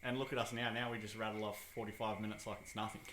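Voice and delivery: ordinary delivery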